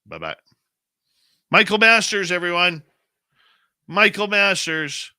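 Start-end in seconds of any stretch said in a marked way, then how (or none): 1.5-5.1 loud, high announcer shout of the name; announcer call-out. Real, not acted. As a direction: hype the guest's name to the crowd. Host hyping the guest name.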